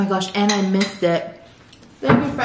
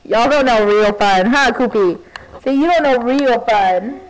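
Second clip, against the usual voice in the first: loud, mock-superior taunt; teasing; 5 dB louder than usual. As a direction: playfully lord it over them. Repeats the taunt louder.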